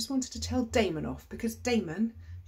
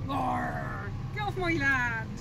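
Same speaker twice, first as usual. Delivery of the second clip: mock farmer growl; 3 semitones higher, 3 dB quieter, much slower than usual. Playful farmer growl on tractor.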